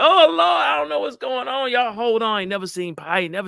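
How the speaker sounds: high, loud mock panic; panicked pilot. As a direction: play a pilot losing it, hammed-up wail. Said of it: "What do you want the pilot to do?".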